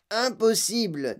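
a hint of a scoffing boy; lightly voiced doubting boy. A narrator's reading. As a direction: draw out the word as a doubting boy. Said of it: "dit Mike T V".

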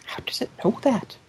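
breathy whispered disbelief. Real, not acted. Reacting to the sci-scan joke, whispered disbelief. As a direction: whisper it, scandalised.

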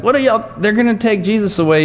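high-pitched mock outrage; worked-up protest, pitched high without shouting; Peter. Preacher voicing Peter as they come for Jesus, acted rage. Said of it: "He's just filled with rage".

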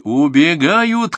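high, loud, stretched yell; triumphant shout. A narrator's reading. Grandson sees the raiders flee, shouting. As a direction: yell that they are on the run. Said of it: "крикнул Артур".

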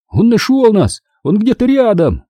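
high-pitched excited cry; exclaiming. A narrator's reading. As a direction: burst out with relief, pitched high. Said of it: "воскликнул я". (радостное восклицание)

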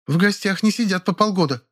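high, cool remark; dry retort. A narrator's reading. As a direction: put her down coolly. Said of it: "заметила Белла". (холодное замечание)

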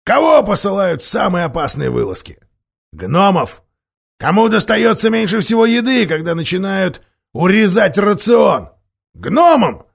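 loud, high, bitter complaint; bitter rant. A narrator's reading. Dwarf complains dwarves always get the worst, loud bitter rant. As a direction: rant with mounting grievance. Nikabrik rants about dwarves' lot.

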